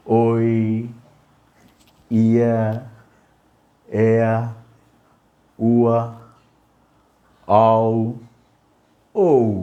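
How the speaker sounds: each diphthong drawn out slowly, long pauses between; slow, sustained vowel drill; slow demonstration. Shown as a demonstration. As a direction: stretch every glide on a steady pitch for learners. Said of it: "కొంచెం స్లోగా".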